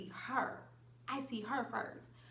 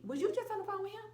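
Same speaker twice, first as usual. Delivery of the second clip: quick high realization; 12 semitones higher, faster than usual. Retold or imitated.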